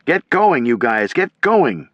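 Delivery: high, loud urging shout. A narrator's reading. Commander shouting at his men to cross. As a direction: drive the men forward. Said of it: "Timmerman was shouting".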